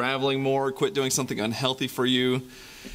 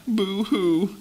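mock boo-hoo; 8 semitones higher than usual. Boy starts to cry.

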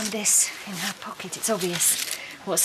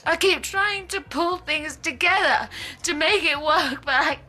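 higher, louder, breaking into a laughing sob; tearful breakdown; 6 semitones higher, 3 dB louder than usual. Acted. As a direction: plead as the tears come through. Witch breaks down crying.

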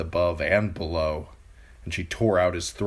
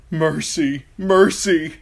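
high, loud panicked pleading; terrified begging; 10 semitones higher, 9 dB louder, slower than usual. A narrator's reading. Theon fleeing wolves in a dream, panicked begging. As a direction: beg as the wolves close in.

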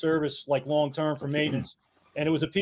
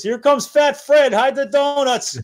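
high, loud, slow announcement; heckling call; 9 semitones higher, 7 dB louder, slower than usual. Retold or imitated. Ball buster heckles priest coming into firehouse, loud teasing call. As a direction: holler it slowly so the target hears.

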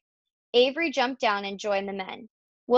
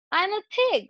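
very high, gleeful boast; show-off boy, read aloud; Avery's excited cry; 11 semitones higher than usual. A narrator's reading. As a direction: cry it out playfully, pitch way up. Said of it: "cried Avery".

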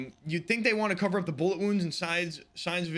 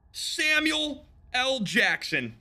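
drawn-out shocked higher cry; startled exclamation; 9 semitones higher, 4 dB louder, much slower than usual. Real, not acted. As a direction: blurt it out startled and slow.

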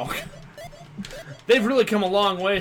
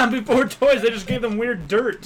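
laughing; 4 semitones higher, 6 dB louder than usual. Real, not acted. Joking about old toys.

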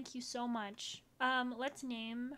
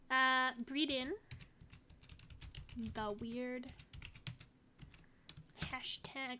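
ordinary delivery

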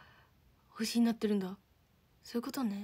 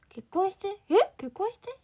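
surprised echo; 9 semitones higher, 8 dB louder than usual. Real, not acted. Reading a marriage proposal from chat.

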